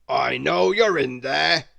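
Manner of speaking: loud, slow dragon growl; a big dragon calling into the bush; booming, menacing dragon voice; rumbling dragon. Acted. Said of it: "rumbled a very deep voice".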